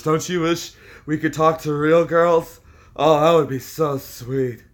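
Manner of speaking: slow, hammed-up dreamy creep voice; slow mock sigh of a creep; mock pervert trolls. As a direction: parody lonely pervert longing.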